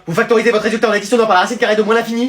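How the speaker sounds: fast, rattled-off explanation; rapid-fire lecture. Acted. Teacher rattles the lesson off too fast for the class. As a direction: rush through it without a breath. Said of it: "vous avez vu à quelle vitesse vous parlez".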